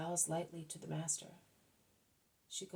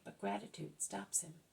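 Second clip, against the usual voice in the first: plain reading voice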